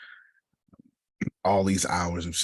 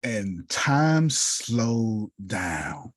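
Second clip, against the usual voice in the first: very slow, stretched out; slowed-down dramatic telling; drawn out; 4 semitones higher, much slower than usual. Real, not acted. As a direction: let the words hang as time slows. Dramatic storytelling moment.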